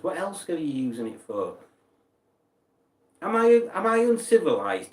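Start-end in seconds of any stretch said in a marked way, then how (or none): 3.2-4.9 mock indignant.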